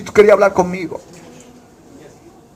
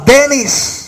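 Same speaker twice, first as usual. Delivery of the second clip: a higher, urgent call of the name, mildly voiced; desperate call; 5 semitones higher than usual. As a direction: call the name out as if waking someone in alarm. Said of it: "Y gritaba". (llamado desesperado, algo agudo)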